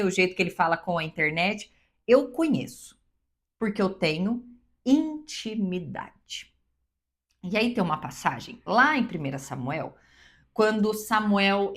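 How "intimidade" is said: "intimidade" very slow, syllable by syllable; stress on intimidade. Real, not acted. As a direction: draw out "intimidade" piece by piece. Key word spelt out slowly. ("intimidade" bem devagar, sílaba por sílaba)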